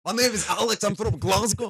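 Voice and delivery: put-on high, loud Glasgow accent; Scottish accent. Comic introducing himself on stage, loud Scottish accent. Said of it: "Just be Scottish".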